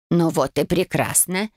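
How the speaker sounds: ordinary delivery, nothing marked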